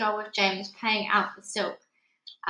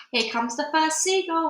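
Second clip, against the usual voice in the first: ordinary delivery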